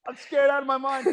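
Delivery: high, hammed-up scared outburst; retold fright. Kid facing his first burger, comic fright. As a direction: play up being scared stiff. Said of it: "I'm terrified of this shit".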